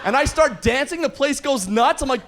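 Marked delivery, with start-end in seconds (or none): none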